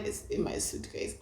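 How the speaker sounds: faint, groggy whisper; drugged whisper. Retold or imitated. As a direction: whisper it as if too drugged to point. Too drugged to point, retold.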